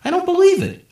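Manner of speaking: high, loud shocked exclamation; disbelief. A narrator's reading. The loser of the bet reacts in loud disbelief. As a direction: sound stunned he lost.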